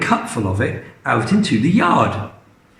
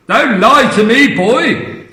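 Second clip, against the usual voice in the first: high, louder scolding; bossy snap; 8 semitones higher, 5 dB louder than usual. Acted. Grandma accusing George of lying, loud scolding. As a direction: scold the boy sharply. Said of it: "the enormous lady said".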